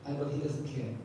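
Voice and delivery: ordinary talk, nothing marked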